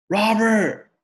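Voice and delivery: loud high call across a distance; distant call. Acted. Father calling his son back from the motel, loud. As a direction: shout the name from far off.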